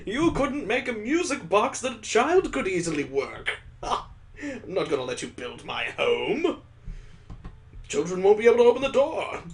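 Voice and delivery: imagine him snapping back and shutting the deal down; mock indignant refusal. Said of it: "I was hoping he'd be like".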